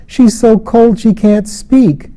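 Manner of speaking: put-on high, slow child's voice; a little boy, anxious; worried child. A narrator's reading. Child worried over cold mother.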